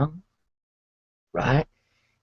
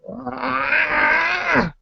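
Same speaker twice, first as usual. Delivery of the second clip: wild cave yell; 13 semitones higher than usual. Retold or imitated. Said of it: "they come out, like".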